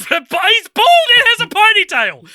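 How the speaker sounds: cracking up mid-sentence; laughing. Real, not acted. Laughing while mocking the actor's ponytail.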